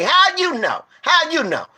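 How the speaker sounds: high, insistent demand; near-shouted how you know; heated challenge. Real, not acted. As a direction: fire the question back at her. Demanding proof from the preacher-lady.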